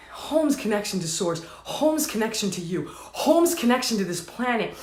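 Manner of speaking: low, soft, intimate teaching; gentle, hushed assurance; soothing affirmation. Real, not acted. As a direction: settle down into a quiet, low repetition. Soothing close after urging.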